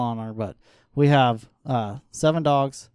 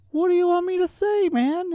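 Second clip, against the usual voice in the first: very high-pitched, baffled protest; retold bewilderment. Guest suddenly put on camera by friend, bewildered. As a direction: act out being caught off guard, voice shooting up.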